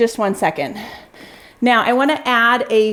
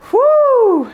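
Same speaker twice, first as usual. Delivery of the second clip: high, loud whoo of effort mid-set; breathless high whoop between reps; exertion whoo; 13 semitones higher, 5 dB louder than usual. Real, not acted. Exhale between workout reps.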